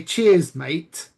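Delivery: British, loud "cheers", dropping on "mate"; British accent. Shown as a demonstration. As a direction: demonstrate a British thanks. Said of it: "if it's British".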